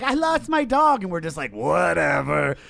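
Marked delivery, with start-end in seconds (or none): 0.0-1.0 high, loud, drawn-out mock wail; hammed-up upset, pitched way up; mock distress. Mocks upset dog owners. 1.5-2.6 long drawn-out brush-off; slow, exaggerated indifference; dismissive. Acts people not caring.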